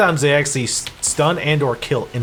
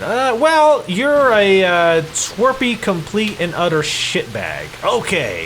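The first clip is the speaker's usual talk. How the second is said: higher, louder, slow deliberate director; 6 semitones higher, 4 dB louder, slower than usual. Retold or imitated. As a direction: spell out the character like a director. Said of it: "see the voice actor in the studio".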